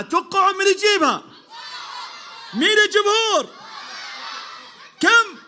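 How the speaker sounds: host shouting to the audience to guess the winner; crowd hype shout. Real, not acted. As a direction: pump up the audience.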